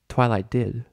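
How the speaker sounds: ordinary delivery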